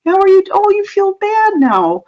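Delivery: put-on high, lilting voice to soothe a baby; sing-song baby talk. Shown as a demonstration. Parent demonstrating, cooing to an upset baby in a high sing-song. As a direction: coo it like to an upset infant. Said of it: "talk to babies in a sing-song voice".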